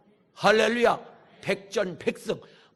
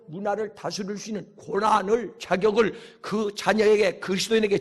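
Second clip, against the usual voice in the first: ordinary talk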